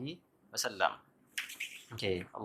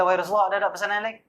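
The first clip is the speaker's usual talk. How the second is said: higher, louder plea for more advice; eager companion asking; 6 semitones higher, 5 dB louder, faster than usual. Acted. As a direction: press him for one more piece of advice. Companion asks again for advice.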